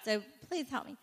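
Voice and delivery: ordinary talk, nothing marked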